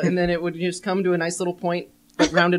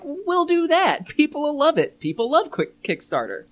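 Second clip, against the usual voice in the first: high, slow smug executive; lightly voiced eager exec, drawn out; smug corporate voice; 8 semitones higher, slower than usual. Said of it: "they're like".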